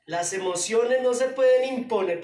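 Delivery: loud preaching climax; fiery preaching. Acted. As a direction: hammer the thesis home. Preaching at full force.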